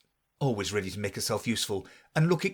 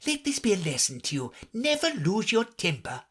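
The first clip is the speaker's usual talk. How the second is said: higher, lightly lecturing mother crab; old crab mother; 9 semitones higher than usual. A narrator's reading. Old crab lecturing her daughter, preachy. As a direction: moralise to your daughter. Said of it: "an old crab".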